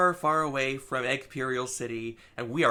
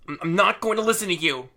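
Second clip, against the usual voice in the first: fast, louder defiant outburst; a cornered hero snapping back; defiant retort; 5 semitones higher, 8 dB louder, much faster than usual. Retold or imitated. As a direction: shout her down in defiance. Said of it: "Surge counters".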